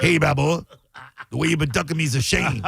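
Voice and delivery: lightly voiced tough-guy taunt, quick; mock Clubber Lang taunt. Podcast host mimics Clubber Lang taunting Rocky, mock tough-guy sneer. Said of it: "and it's like".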